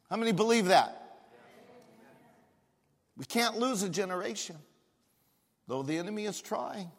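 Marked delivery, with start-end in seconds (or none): none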